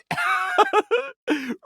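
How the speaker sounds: a sudden high-pitched burst of laughter. Real, not acted. Laughing at absurd story.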